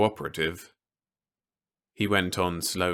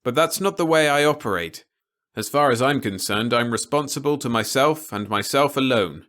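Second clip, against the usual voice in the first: raised, louder, stubborn reply; defiant Peter; 5 semitones higher, 6 dB louder than usual. A narrator's reading. Peter refusing to be told what to say, lightly voiced defiance. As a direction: push back hard against orders.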